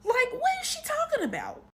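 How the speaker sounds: incredulous. Retold or imitated. Incredulous retold reaction.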